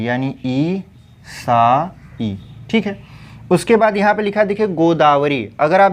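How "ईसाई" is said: ईसाई very slowly as ई, सा, ई; syllable by syllable. Shown as a demonstration. Teacher spelling it out for students, slow ईसाई. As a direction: separate and hold each sound of ईसाई. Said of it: "ईसाई होता है".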